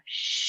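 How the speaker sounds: a long hushing shh as the breath goes out; noisy shh exhale. Shown as a demonstration. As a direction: breathe out through the mouth on a shh. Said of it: "So it sounds like an inhale".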